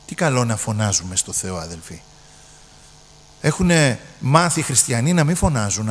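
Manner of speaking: ordinary talk, nothing marked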